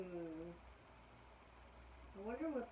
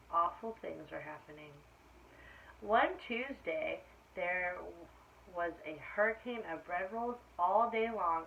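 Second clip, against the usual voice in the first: put-on story reading to children; 2 semitones lower, 4 dB louder than usual. A narrator's reading. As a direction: draw out the bad weather for the kids. Reading picture book aloud.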